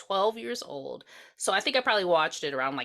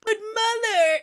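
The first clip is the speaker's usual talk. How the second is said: high, loud pleading "But Mother"; hammed-up wounded son; Albert's plea; 13 semitones higher, 6 dB louder, slower than usual. [name] reenacting Albert hurt by his mother, high plea. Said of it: "Here, say it, [name]".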